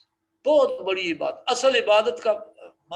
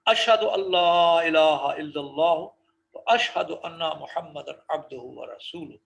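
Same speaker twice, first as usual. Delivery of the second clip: slow, drawn-out Arabic recitation; 2 semitones lower, 5 dB louder, slower than usual. Shown as a demonstration. Teacher slowly reciting the testimony for students. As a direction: intone each word slowly.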